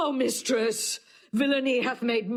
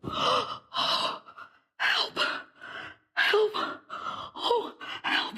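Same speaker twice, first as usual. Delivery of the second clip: much higher, desperate calls; crying for help; 10 semitones higher, much slower than usual. Acted. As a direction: call out in panic for anyone. Emilia calling out after the murder.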